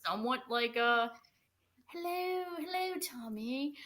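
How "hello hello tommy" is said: put-on high, soft British-accented greeting. Pretending to be a spirit calling someone, British accent. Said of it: "just said that in a British accent".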